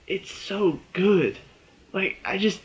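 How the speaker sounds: long, drawn-out high "It's so good"; swooning over a favorite show; drawn-out gush. Real, not acted. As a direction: savor every word of "It's so good".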